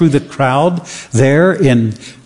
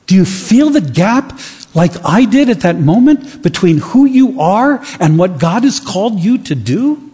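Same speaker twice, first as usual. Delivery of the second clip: rising preaching; 5 semitones higher than usual. Acted. Preacher challenging the church about the gap, building to full force. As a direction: press the congregation harder with each question.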